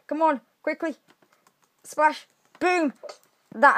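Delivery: ordinary talk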